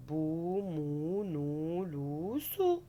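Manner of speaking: each syllable said slowly and alone; slow syllable reading. Shown as a demonstration. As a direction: read the syllables out one by one. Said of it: "Donc on va lire". (lecture lente des syllabes)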